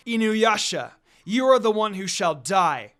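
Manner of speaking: vengeful shout. Acted. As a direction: declare his death as you shoot. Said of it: "Kikyo shouted".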